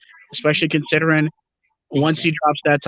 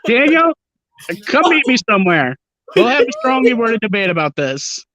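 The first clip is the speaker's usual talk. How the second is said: mock challenge; 7 semitones higher than usual. Playful challenge to a commenter.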